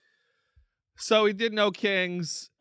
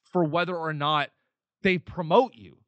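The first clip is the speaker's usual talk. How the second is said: ordinary delivery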